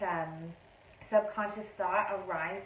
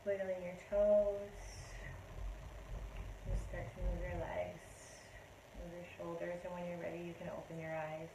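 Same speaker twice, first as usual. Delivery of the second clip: guided meditation; 2 semitones lower, 11 dB quieter than usual. Acted. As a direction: gently bring them back. Leads a meditation.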